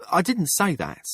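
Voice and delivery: angry edge on a sharp denial; edge of anger. A narrator's reading. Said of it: "an edge of anger in her voice".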